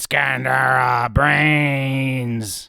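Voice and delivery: slow shouted zombie growl; slow loud zombie voice; rage zombie. Acted. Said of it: "that was my attempt at a zombie noise".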